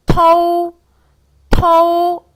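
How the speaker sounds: 터우 loud and high with a 어 glide into 우; pinyin tou demo. Shown as a demonstration. Teacher modeling the combined syllable, loud demo. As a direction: belt out 터우 as one clean syllable. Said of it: "트어우 시작".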